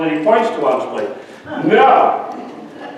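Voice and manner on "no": put-on a sharp, high breathy kid's no; sudden little-boy fit; toddler tantrum. Grandson refusing the pizza, high tantrum no.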